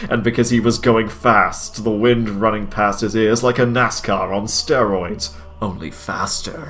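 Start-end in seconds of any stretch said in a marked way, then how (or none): 5.6-6.7 breathy mock-dramatic whisper. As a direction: hush it like a secret. Dramatic narration aside.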